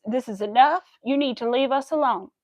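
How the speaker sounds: ordinary talk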